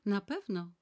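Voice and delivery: firm confirmation. Acted. Decisive yes, landing hard on na. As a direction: Nana firmly confirming the appointment to Tricon.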